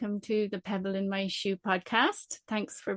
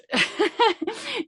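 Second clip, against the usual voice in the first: light laugh; 12 semitones higher than usual. Real, not acted. Host laughs at her own phrase.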